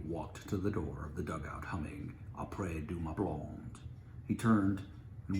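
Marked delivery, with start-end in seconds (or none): none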